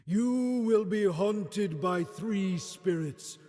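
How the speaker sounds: slow, loud, hollow ghostly pronouncement; Marley's ghost. Acted. Ghost warning Scrooge of three spirits, slow and booming. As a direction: boom it out like a spirit from the grave.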